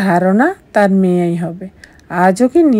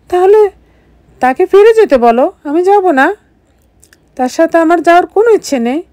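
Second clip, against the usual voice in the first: higher, louder defiant refusal; angry refusal; 9 semitones higher, 4 dB louder than usual. Acted. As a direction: flatly refuse him, voice raised. Refusing to go back with husband. (উঁচু গলায় রাগী অস্বীকার)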